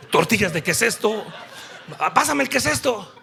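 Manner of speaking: laughing through the joke. Real, not acted. Broma sobre el maná. (riendo mientras bromea)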